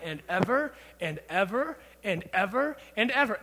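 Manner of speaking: each "ever" swung upward in a repeating lilt; relentless repeated lift on "ever"; drawn-out emphasis. Shown as a demonstration. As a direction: keep "and ever" rolling on, sing-song. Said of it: "emphasizing my point".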